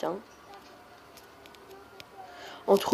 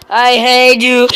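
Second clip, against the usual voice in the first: loud, drawn-out hate; mock-hateful yell; exasperated shout; 3 semitones higher, 11 dB louder, much slower than usual. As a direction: explode at the game. Frustrated, bursts out.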